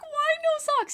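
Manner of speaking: very high, loud laughing retelling; giggly, high-pitched disbelief; laughing squeal. Real, not acted. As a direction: squeal it through helpless laughter. Laughing as she retells her question.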